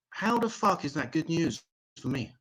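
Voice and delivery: ordinary talk, nothing marked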